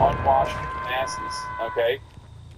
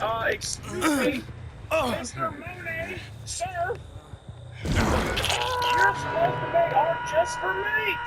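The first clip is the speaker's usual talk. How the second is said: mock medieval complainer, pitched way up, drawn out; medieval gooner; 13 semitones higher, slower than usual. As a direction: object to the painter in a high, slow British voice. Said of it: "Medieval Gooner".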